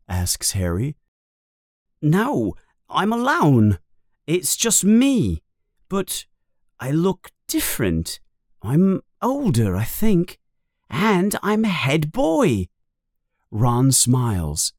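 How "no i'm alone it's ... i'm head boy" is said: put-on high, halting boyish voice; Ron's wondering voice. A narrator's reading. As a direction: pause between phrases, amazed at the sight. Narrator voices Ron at the mirror.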